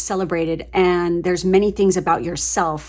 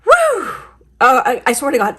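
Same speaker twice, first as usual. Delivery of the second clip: excited; 6 semitones higher, 6 dB louder than usual. Real, not acted. Overwhelmed by reading result.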